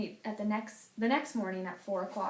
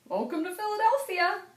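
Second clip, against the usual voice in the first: higher, bright welcoming voice; 10 semitones higher, 4 dB louder than usual. Acted. Four men welcoming Henry out of the box. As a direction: greet him joyfully.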